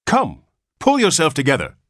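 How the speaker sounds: high, loud firm command. A narrator's reading. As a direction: order him to pull himself together. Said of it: "commanded the nurse".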